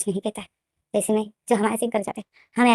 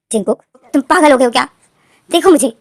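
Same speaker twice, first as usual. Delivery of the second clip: loud, higher outraged cry; shocked protest; 4 semitones higher, 8 dB louder than usual. Acted. V shouts back at Jinkook in disbelief. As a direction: yell it in disbelief.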